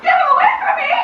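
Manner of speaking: high, loud plea in fright; terrified wife shrieking for help; frightened cry. Acted. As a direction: beg to be saved, panicking. Frightened of the boy.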